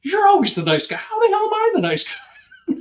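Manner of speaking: high, laughing, disbelieving mimic of his partner; mock indignant partner. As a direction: play him scoffing through a laugh. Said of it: "he always sat there and goes".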